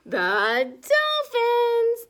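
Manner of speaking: high, loud delighted name; excited reveal. Acted. Reading to children, revealing the dolphins with delight. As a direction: cry out with joy.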